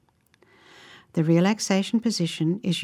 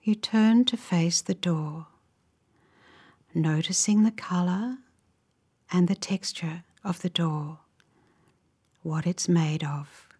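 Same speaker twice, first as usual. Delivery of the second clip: unhurried, gentle phrases, leaving space to imagine; guided relaxation. Real, not acted. Guide leading listeners into a calming visualization, slow guided meditation.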